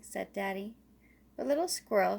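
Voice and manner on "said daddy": plain reading voice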